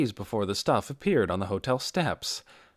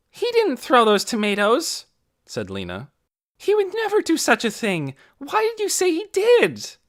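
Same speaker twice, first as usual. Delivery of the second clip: much higher, louder, indignant; angry scolding; 7 dB louder than usual. A narrator's reading. Lena scolds Torrin for lying about Doone, angry. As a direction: tell him off for the lie.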